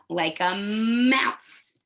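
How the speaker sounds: "mouse" drawn out slowly with a rising end; slow model word. Shown as a demonstration. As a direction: stretch "mouse" slowly for the class. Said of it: "Look at my lips".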